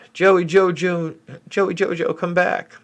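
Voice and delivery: high, slow sing-song call after a departing viewer; playful calling out. Pretend. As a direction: coax them back in a high lilting voice. Calls after a leaving viewer.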